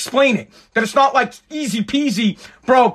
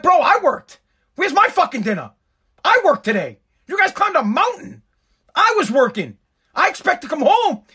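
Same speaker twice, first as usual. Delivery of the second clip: much higher, exasperated retold complaint; indignant rant; 8 semitones higher than usual. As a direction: demand his dinner, outraged. Retold argument with his family.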